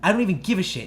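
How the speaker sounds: loud, high, defiant comeback; retold screaming tirade. Teen orders the mom out of the party, yelling. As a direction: throw the order straight back, re-enacted. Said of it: "screaming at the top of my lungs".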